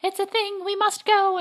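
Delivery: high, drawn-out mock obedience; mock entranced follower. Co-host mimics crowd called by loudspeaker, high and slow. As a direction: play someone summoned by a loudspeaker, pitched high.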